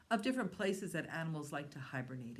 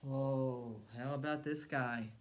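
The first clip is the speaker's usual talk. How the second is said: ordinary talk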